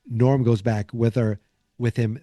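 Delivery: usual speaking voice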